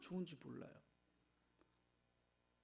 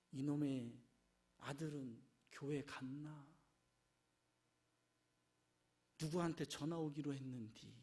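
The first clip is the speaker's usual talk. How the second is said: distracted inner musing; 9 semitones lower, 6 dB quieter than usual. Worshipper's distracted inner thoughts.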